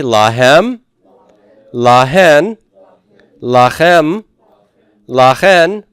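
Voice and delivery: lahem, lahen, lachem, lachen with the second syllable punched and rising; stress on final syllable. Shown as a demonstration. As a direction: model each word for repetition, leaning on its last syllable. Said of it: "The second and final".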